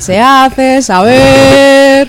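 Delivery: slow, drawn-out proclamation; town crier. Pretend. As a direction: announce it like a herald. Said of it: "¿Volverá el pregonero también?". (pregonero solemne y lento)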